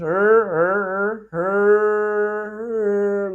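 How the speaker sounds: drawn-out American 'er, er', held long. Shown as a demonstration. Teacher showing the sixth problem, exaggerated American R. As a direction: hold a long curled-tongue American 'er'. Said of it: "the American one".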